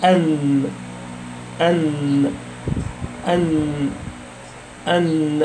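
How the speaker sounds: "أن" with a long, humming final n; démonstration du noun. Shown as a demonstration. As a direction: hold the n of "أن", low and soft, as a model. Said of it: "قول كده".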